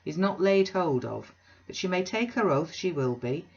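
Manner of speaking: a loud, bluff self-made man; blustering Bounderby. Acted. Bounderby booming an invitation to Mrs Sparsit. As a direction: boom the invitation like a braggart host.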